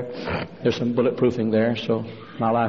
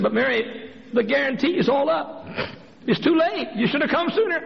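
high voiced woman explaining kindly; high-pitched nurse voice; dentist's wife; 14 semitones higher than usual. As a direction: voice her high and patient.

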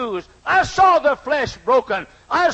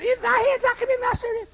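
fast, high, softer sing-song parody; mocking; 12 semitones higher, 5 dB quieter, faster than usual. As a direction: rattle off his prayer in scorn. Sinner mocking Jesus's words.

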